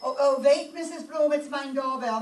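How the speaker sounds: calling out. Acted. Hurrying, calling to Mrs Bloom.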